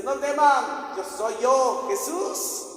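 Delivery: high, loud, ringing call; acted calling out. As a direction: call across the water to them.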